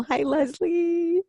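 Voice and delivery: high, drawn-out hello; sing-song greeting. Real, not acted. Host spots an attendee joining the webinar, high sing-song greeting.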